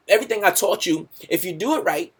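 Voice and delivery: usual speaking voice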